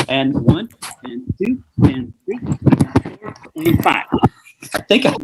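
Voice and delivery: workout count. Acted. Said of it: "a home workout video".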